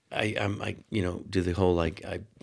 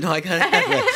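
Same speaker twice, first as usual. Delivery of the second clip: laughing insistence; 9 semitones higher, 6 dB louder than usual. Retold or imitated.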